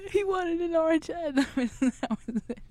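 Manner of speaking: high squeal through real laughter; helpless laughing. Real, not acted. Joke teller cracking up at own punchline, helpless high laughing. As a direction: squeeze the words out while cracking up.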